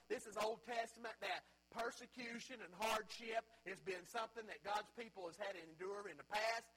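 ordinary talk

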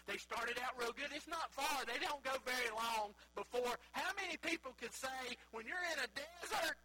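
loud, high-pitched sermon delivery; full-force preaching. Real, not acted. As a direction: preach it out to the whole congregation.

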